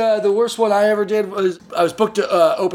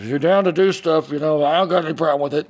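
lower, mumbled creep, hurried at the end; creepy cousin; 6 semitones lower than usual. Retold or imitated. Cousin cornering her at family functions, sleazy mutter. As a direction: sidle up and make the offer casually. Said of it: "pull her aside and go".

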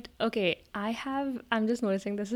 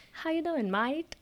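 badly faked, high-pitched Aussie greeting; amateur Australian accent demo; horrible Australian accent; 9 semitones higher, slower than usual. Shown as a demonstration. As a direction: attempt an Australian accent and get it wrong.

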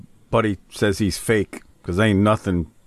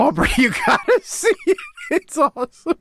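laughing. Real, not acted. Gushing about the absurd clip.

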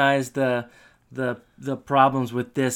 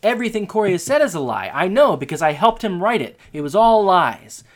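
louder, higher retold accusation; mock indignation; 4 semitones higher, 6 dB louder than usual. Rival exposing the candidate's speech, mock indignant. Retelling rival's answer in a quarrel.

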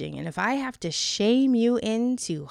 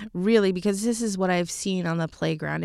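ordinary delivery

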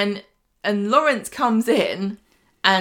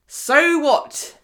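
higher, louder mock indignation; punchy "so what" in a retold quote; dismissive retort; 7 semitones higher, 5 dB louder than usual. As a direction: brush it off, voice up. Acting Sean brushing off the affair.

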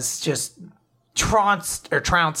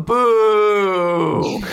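loud playful boo; mock boo; 5 semitones higher, 6 dB louder than usual. Mocking the evil cloud rider, loud playful boo. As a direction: jeer it like a crowd.

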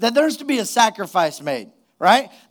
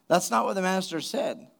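ordinary delivery

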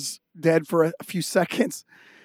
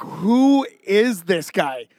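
stunned disbelief; much slower than usual. Retold or imitated. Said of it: "we're just like".

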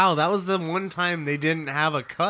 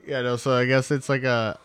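ordinary talk, nothing marked

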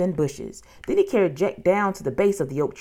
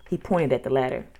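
plain reading voice

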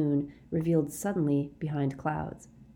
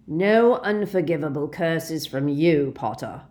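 sneering shout; 4 dB louder than usual. A narrator's reading. Snape sneering at Harry over the flames, shouting. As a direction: shout it scornfully over the noise. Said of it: "he shouted over the rushing of the flames".

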